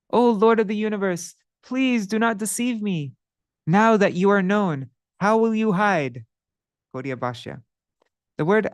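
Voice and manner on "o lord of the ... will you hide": higher, earnest plea to the Lord; the sinner Madhai begging the Lord for mercy, higher pitched; pleading sinner. Acted. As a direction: beg for mercy, voice lifted.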